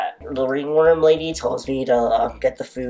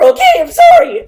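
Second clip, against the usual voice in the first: very high, loud shriek of apology; sudden cry-shout; 12 dB louder than usual. Real, not acted. Vlogger breaks into a shrieking outburst at the camera. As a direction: burst out shrieking, then sorry.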